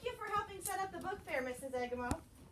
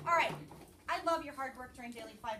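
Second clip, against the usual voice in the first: usual speaking voice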